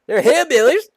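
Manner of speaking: high, loud mock-angry yell; hammed-up outburst; hollering. A narrator's reading. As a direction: holler it as the worked-up mayor. End of the mayor's acted rant.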